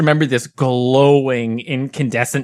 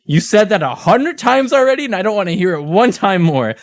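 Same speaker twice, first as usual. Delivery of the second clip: scolding grandma; 7 semitones higher than usual. Retold or imitated.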